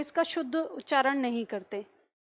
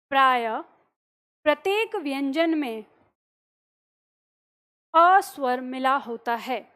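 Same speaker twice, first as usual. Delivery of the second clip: repeated dictation; 2 semitones lower than usual. Shown as a demonstration. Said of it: "अगला point लिखिए".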